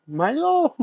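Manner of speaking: high meowed Milo; cat's meow. Retold or imitated. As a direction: mimic the cat yowling its name. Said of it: "And he's like".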